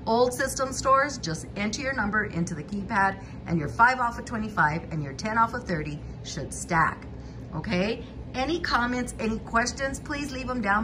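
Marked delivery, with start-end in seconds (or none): none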